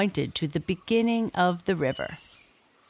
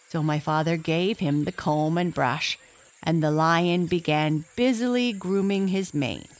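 ordinary delivery

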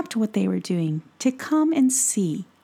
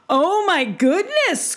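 much higher, louder and slower outcry; alarmed cry; 10 semitones higher, 4 dB louder than usual. Acted. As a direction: cry out in shock. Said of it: "cried the woodsman".

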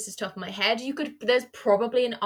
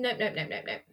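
firm refusal; 7 dB quieter than usual. Real, not acted. Answering talk of a sequel, fading flat nopes. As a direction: shut the idea down flatly. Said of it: "What are your feelings on that".

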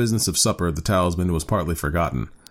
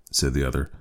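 usual speaking voice

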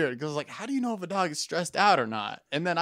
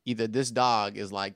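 ordinary delivery

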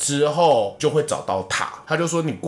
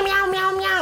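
high imitated cat meows; imitated meowing. Lost cat answers its owner from the apartment, high mock meows. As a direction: squeak out the cat's answer.

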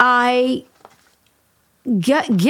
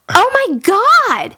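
high, loud, slow and stricken; reliving the shock of the lost door; a stunned, near-tearful oh my God; horrified gasp; 11 semitones higher, 5 dB louder, slower than usual. Retold or imitated. Door torn off, about to cry.

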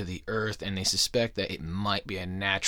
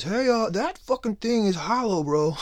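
much higher, louder dude-bro voice; mock scientist realizing; 11 semitones higher, 5 dB louder than usual. Host imitates scientists discovering the hollow moon, high and loud. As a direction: squeal it like a stunned bro. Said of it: "they realize".

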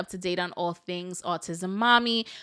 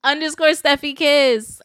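louder handle, laughing; 5 semitones higher, 7 dB louder than usual. Shown as a demonstration. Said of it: "Tag me".

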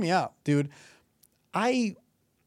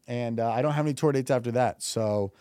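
ordinary talk

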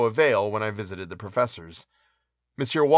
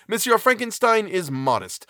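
higher-pitched character voice, unlike the narration; pitched-up pompous professor; blustering professor; 8 semitones higher than usual. A narrator's reading. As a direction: pronounce it in the professor's raised, emphatic tone. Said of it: "continued he".

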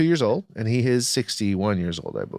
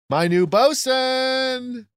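grand declaration; 13 semitones higher, much slower than usual. Real, not acted. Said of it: "declares it grandly".

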